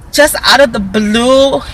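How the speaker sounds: ordinary delivery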